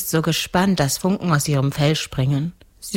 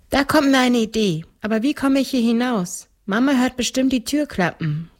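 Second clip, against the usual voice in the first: put-on higher little-girl voice; seven-year-old girl; 8 semitones higher than usual. (kindliche Mädchenstimme)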